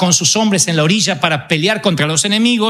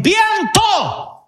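commanding the wind. Acted. Discípulos ordenan al viento.

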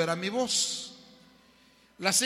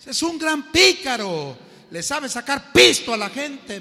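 put-on indignant young man; 7 semitones higher, 10 dB louder than usual. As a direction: hammer out each accusation. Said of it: "me dijo".

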